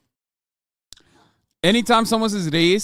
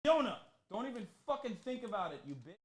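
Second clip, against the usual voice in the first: higher scolding call, far from the mic; scolding the dog; 5 semitones higher, 16 dB quieter than usual. Real, not acted. Streamer warning his dog Fiona off the chew, scolding. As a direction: warn the dog off from across the room.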